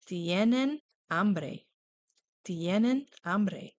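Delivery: "Tienen hambre" very slowly, each syllable drawn out; slow model phrase. Shown as a demonstration. Teacher slowly models the plural question for kids. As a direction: stretch it out for learners. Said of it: "Do you remember how to ask".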